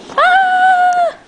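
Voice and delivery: high loud playful shriek; mock horror scream. Making a horror movie with her dog, a hammed-up shriek.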